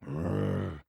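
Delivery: deep rumbling engine roar; low roar. A narrator's reading. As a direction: rumble it out in anger. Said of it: "Diesel roared".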